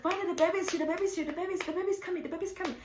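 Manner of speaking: high, chattering repeated alert; excited announcement. Retold or imitated. Acts out announcing the baby's arrival.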